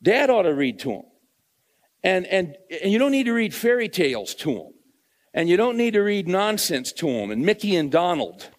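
loud, high, driving pulpit voice; fired-up preacher hammering his point home; full-force preaching. Acted. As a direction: preach it hard to the congregation.

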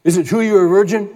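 reporter's yell, pitched high; half-hammed reporter yell; mock yell. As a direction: call out across a noisy press room. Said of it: "one guy yells at him".

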